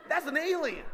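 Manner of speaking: loud, high comic exclamation; hammed-up appalled reaction; mock horrified outburst. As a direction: blurt it out in disbelief. Mock horror at the newborn.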